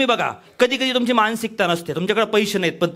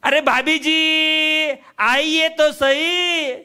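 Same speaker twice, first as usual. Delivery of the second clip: high, very slow coaxing hawker; 6 semitones higher, much slower than usual. Retold or imitated. Pushy hawker coaxing the refusing housewife, slowly and sweetly. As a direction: wheedle her to come look.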